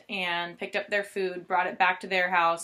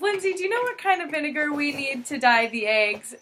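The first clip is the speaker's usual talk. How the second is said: lighter mom imitation; 6 semitones higher than usual. Mom asking Lindsay, retold.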